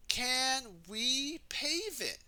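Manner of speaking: high, slow eager question; Wendy's hopeful question. Acted. Wendy asking her team, high and slow. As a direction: ask it wide-eyed and slow, like a cheerful builder.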